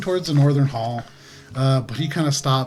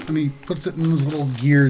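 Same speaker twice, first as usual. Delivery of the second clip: ordinary talk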